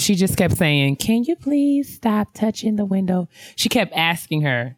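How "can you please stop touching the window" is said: meek pleading aunt. Retold or imitated.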